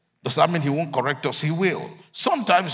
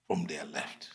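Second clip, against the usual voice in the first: whisper; 10 semitones lower, 12 dB quieter than usual. Retold or imitated. God speaking gently to Jonah.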